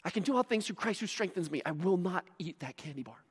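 hushed self-talk; quiet, hammed-up willpower mantra; mock dieter's resolve. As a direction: recite it softly to yourself, resisting temptation. Mimics dieter reciting verse to resist.

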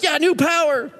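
high, chirpy, careless; Paul shrugging off the words, high and flippant; mock casual Paul. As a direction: breeze through it like a slogan. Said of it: "And he's like".

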